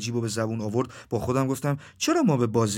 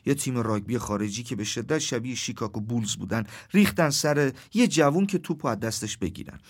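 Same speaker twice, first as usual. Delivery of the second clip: ordinary delivery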